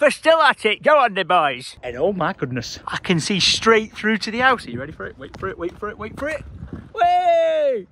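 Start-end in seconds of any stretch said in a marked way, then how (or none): 4.7-6.4 hushed build-up. Real, not acted. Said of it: "building up to a reveal". 6.9-7.9 excited reveal. Real, not acted. Reveal of the view.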